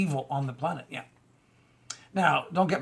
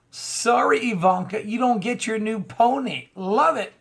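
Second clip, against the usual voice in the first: higher, louder taunting singsong; mock sympathy; 6 semitones higher, 4 dB louder than usual. Commentator taunting the president's daughter over her lost deal, mock-pitying. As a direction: gloat as if denying a spoiled child. Mocking Ivanka as spoiled.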